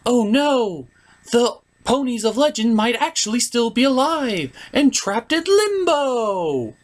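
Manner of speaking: high, gasping mock alarm; hammed-up pony gasp. Pony discovering the legends trapped in limbo, mock alarmed. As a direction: gasp and panic like a cartoon pony.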